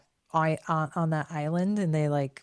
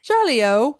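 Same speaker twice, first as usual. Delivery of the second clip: higher, louder go at Australian, badly faked; botched Australian accent; 7 semitones higher, 5 dB louder than usual. Shown as a demonstration. Trying Australian after fearing it comes out Jamaican, botched accent. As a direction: fumble an Australian accent into something British. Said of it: "I might come out Jamaican".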